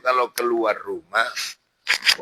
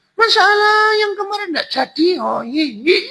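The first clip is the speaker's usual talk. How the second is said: quick, insistent falsetto; high hammed-up woman; woman's voice. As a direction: jog his memory in a very high female voice. Voicing the veiled woman.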